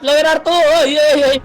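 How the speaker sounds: high, loud urgent yell; alarmed shout. Real, not acted. Player yelling a warning to teammates, alarmed shout. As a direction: shout the warning to your team, voice shooting up.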